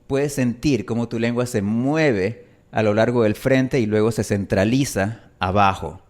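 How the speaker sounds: usual speaking voice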